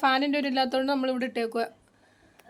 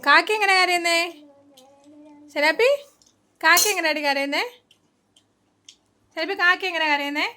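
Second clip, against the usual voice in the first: coaxing pet; 4 semitones higher than usual. Real, not acted. Coaxing bird to caw.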